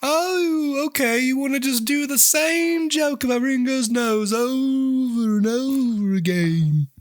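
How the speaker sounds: 1 Paul. Retold or imitated. Paul voice mocking [name].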